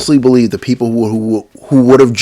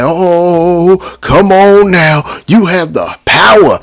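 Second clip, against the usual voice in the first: higher, louder hammed-up preacher plea; mock preacher; 9 semitones higher, 5 dB louder, slower than usual. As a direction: ham up a Sunday preacher begging the crowd. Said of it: "they sound like a preacher on a Sunday morning".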